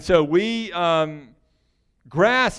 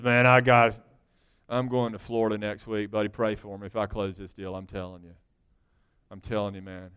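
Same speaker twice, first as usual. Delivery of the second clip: businessman; 9 semitones lower, 5 dB quieter than usual. Retold or imitated. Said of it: "an American businessman".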